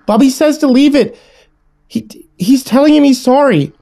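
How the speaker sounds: put-on very high, agitated child's voice; insistent little sister. A narrator's reading. Little sister stops sibling bagging the dead rabbit, insistent. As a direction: protest shrilly as a little girl.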